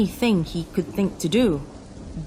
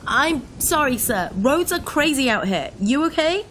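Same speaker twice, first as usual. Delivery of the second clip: raised, high-pitched call; shouting over rain; 10 semitones higher, 4 dB louder than usual. A narrator's reading. Driver apologizes to the officer, shouting over the rain. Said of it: "I shouted over the rain".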